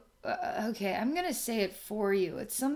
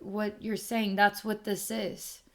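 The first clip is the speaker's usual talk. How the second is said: normal talking voice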